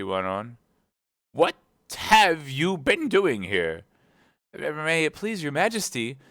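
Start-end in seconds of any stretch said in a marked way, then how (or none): 1.3-3.8 high, loud, slow interrogation; imperious queen. Acted. Queen questioning gardeners by the rose tree, imperious. 4.5-6.2 higher-pitched, humble appeal. Acted. Gardener Two appealing to the queen, very humble. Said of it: "in a very humble tone".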